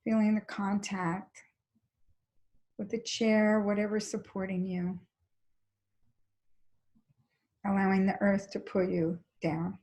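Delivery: calm, even body-scan guidance with long pauses; guided meditation. Real, not acted. Teacher leading a class into a body scan, calm guided meditation. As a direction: guide them gently, leaving space between phrases.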